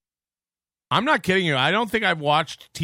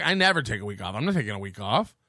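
low, fast, whiny mock voice; mocking imitation; 8 semitones lower, 4 dB quieter, faster than usual. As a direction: mimic a stubborn whiner, lower. Mocking Giroux's refusal.

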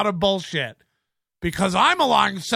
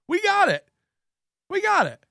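high sarcastic sing-song; 10 semitones higher than usual. Real, not acted. Sarcastic to the flaky guest.

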